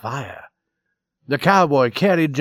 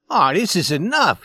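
higher, pleading appeal; begging; 6 semitones higher, 4 dB louder than usual. A narrator's reading. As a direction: plead with them to stop. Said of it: "he begged".

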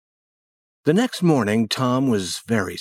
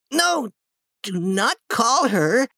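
high-pitched pleading cry, not much louder; 12 semitones higher, slower than usual. Acted. Tom begging Sid not to call aunt, high cry. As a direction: protest in a high, pained boy's voice. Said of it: "he cried loudly again".

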